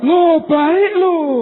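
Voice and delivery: high, very slow, loud dopey voice; high slow mock fool; foolish official. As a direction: drawl it like a dim-witted village head.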